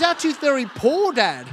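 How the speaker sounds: put-on high, slow little-boy voice; sad little son. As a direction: ask like a disappointed kid. Son asks sadly.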